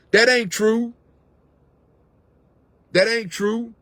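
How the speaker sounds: high mocking whine of a doubter. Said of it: "waiting for somebody to say".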